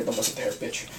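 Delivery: hushed whisper aside. Pretend. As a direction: whisper it behind his back. Mock insult whispered aside.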